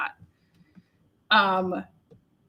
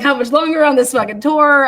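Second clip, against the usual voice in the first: exasperated complaint; 2 semitones higher, 8 dB louder than usual. Retold or imitated. Fed up on the tour.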